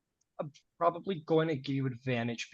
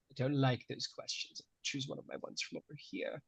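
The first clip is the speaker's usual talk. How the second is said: very soft, breathy whisper to himself; whispered aside; 11 dB quieter than usual. Real, not acted. GM talks to himself behind the screen, whispering. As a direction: whisper it under your breath.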